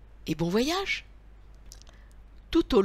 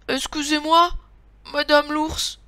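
higher, lightly voiced little-girl apology; apologetic little girl; 7 semitones higher, 4 dB louder than usual. A narrator's reading. Boucle d'Or apologises to mama bear, light higher voice. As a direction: raise the pitch slightly, politely apologetic.

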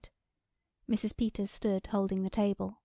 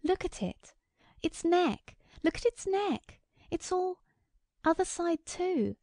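high, agitated cries of horror; shocked, high-pitched insistence; horrified cry; 9 semitones higher than usual. A narrator's reading. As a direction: cry out urgently, pitch soaring. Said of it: "cried Missus Hale".

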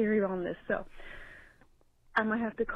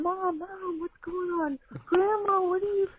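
alarmed relatives; 8 semitones higher than usual. Retold or imitated. Family reacting to grandma.

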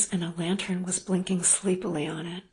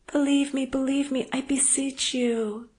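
louder, higher, imploring; pleading; 7 semitones higher, 5 dB louder than usual. Acted. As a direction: beg him to believe her. Woman pleading with lover.

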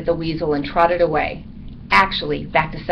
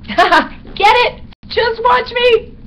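very high, loud squeaky bat, slow on "Just watch me"; gleeful punning; 6 dB louder, much slower than usual. A narrator's reading. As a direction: squeal the pun with delight. Bat repeats his line as pun.

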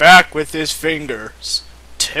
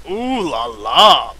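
mock French; 3 dB louder than usual.

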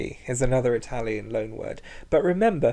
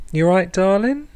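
camp greeting; 7 semitones higher, 8 dB louder than usual. Shown as a demonstration. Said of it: "Either a simple".

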